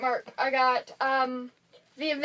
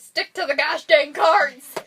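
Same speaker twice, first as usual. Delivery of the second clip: loud, comic scolding; playfully hammed-up exasperation; exasperated mock order; 3 semitones higher, 9 dB louder than usual. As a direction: bark it as a mock-urgent reminder. Imagined scolding whisper, said loud.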